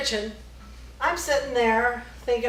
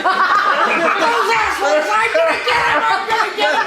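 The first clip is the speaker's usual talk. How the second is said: Yosemite Sam rant; 8 semitones higher, 8 dB louder than usual. Retold or imitated. Teller mimicking husband as an angry cartoon cowboy, laughing. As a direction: imitate a hopping-mad cartoon cowboy through giggles. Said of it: "jumping around like Yosemite Sam".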